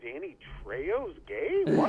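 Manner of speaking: slow, stunned question; shocked disbelief. Retold or imitated. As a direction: act stunned and confused. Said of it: "but it's like".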